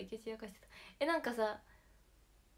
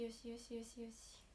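whispering; 10 dB quieter than usual. Pretend. Soothing the bear plushies.

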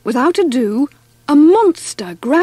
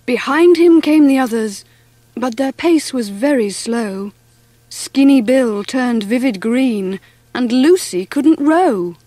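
plain reading voice

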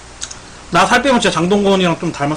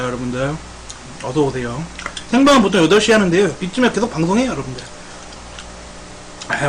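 usual speaking voice

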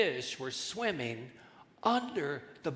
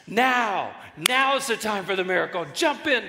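loud acted go signal; commanding call; 6 semitones higher, 7 dB louder than usual. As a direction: call the fish into action. Jesus calling fish to jump.

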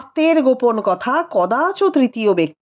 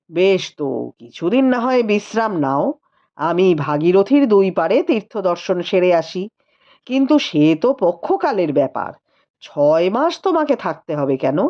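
a touch lower, weary and teasing; tired rider brushing off questions; lightly voiced tired husband; 8 semitones lower than usual. A narrator's reading. Rupendra answering the sister-in-law, mildly weary.

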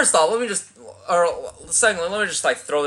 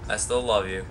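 softer, lower tender repeat; soft sincere; 7 semitones lower, 8 dB quieter than usual. Real, not acted. Streamer telling his friend Scott softly he loves him. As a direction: say it gently, meaning it. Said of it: "But I still love you".

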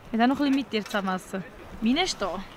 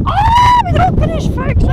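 aufgeregt; 14 dB louder than usual. Real, not acted. Schreibt in den Sand.

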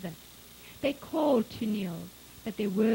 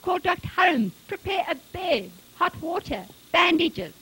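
high, loud volley of orders; strained, urgent shout; 7 semitones higher, 7 dB louder than usual. A narrator's reading. Husband sending his wife for help, urgently shouting. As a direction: fire off desperate commands. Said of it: "Retief's voice was cracking".

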